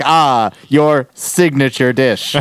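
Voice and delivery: slow, drawn-out reply with a pause after Ah; stilted game character. Retold or imitated. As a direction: say it like scripted game dialogue, slowly. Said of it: "and then they're like".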